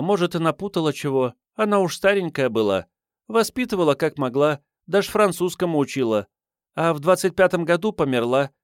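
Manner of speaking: put-on high girlish reminiscing voice; waif's fond recollection turning protective; fond, feisty street girl. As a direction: speak high, loyal to an old nanny. Voices the girl recalling her nanny.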